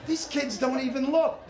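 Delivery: ordinary talk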